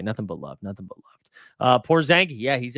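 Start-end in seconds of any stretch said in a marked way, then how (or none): 0.0-1.2 soft, gentle reassurance; reassuring. Real, not acted. Streamer to Ryan after joke threats, soft reassuring. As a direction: make peace with him softly.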